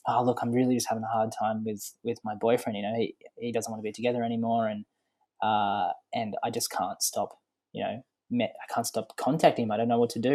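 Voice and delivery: a touch of a scared client confessing; nervous woman. Retold or imitated. Imitating the woman in the video, mildly nervous and soft. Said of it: "nervous and scared".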